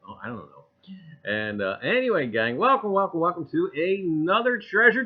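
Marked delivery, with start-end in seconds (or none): none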